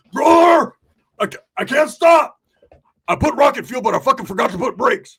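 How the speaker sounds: high, loud cry for help; panicked shout. Retold or imitated. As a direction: yell it like you can't stop the runaway mower. Runaway mower rider calling out in panic.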